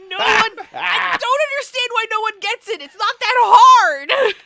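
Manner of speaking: exasperated shout. Real, not acted. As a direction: shout your disbelief at the table. Exasperated rant about Thaco.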